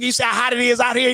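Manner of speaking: ordinary delivery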